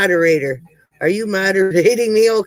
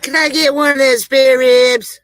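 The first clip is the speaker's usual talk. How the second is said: put-on Southern accent; 6 semitones higher, 5 dB louder than usual. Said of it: "had Southern accents".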